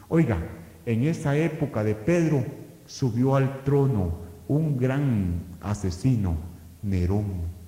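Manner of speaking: usual speaking voice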